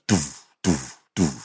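low hummed doof doof beats; EastEnders drum sting. Retold or imitated. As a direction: mimic the soap's cliffhanger drums. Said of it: "and it goes".

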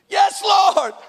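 high, projected cry of assent; hammed-up obedient shout; mock eager yes. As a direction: call it out like a keen believer. Mimics eager believer answering God.

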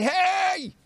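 high, drawn-out "hey"; mock policeman's call. Rabbi pretends to chase the son, mock high call. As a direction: call after someone running away, hammed up. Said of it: "go running after him and say".

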